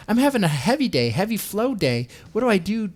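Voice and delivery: high, quick mock of a frantic question. Mocking Yaniv's period questions in a high voice. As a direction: ham up a clueless plea. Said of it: "questions like".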